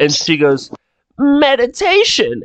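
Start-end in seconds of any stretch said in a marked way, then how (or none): 1.2-2.4 put-on high, knowing old-maid voice; old maid, winking. Maid hints at what her master does, high suggestive old-lady voice. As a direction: say it with a wink and a nudge. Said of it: "And she winks and, like, nudges you".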